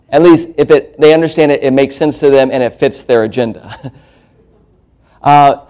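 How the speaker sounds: usual speaking voice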